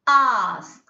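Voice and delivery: "ask" with a long ah; prolonged ah. Shown as a demonstration. Teacher giving an example word, prolonged ah. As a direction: stretch the vowel in "ask". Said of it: "you have to prolong it".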